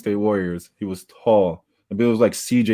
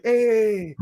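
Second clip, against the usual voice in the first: mock greeting call; 10 semitones higher, 3 dB quieter than usual. Retold player's answering call.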